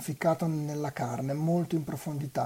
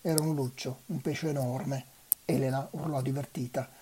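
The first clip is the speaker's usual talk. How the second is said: usual speaking voice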